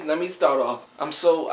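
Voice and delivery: ordinary delivery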